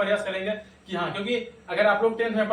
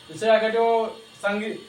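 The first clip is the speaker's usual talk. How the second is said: ordinary talk, nothing marked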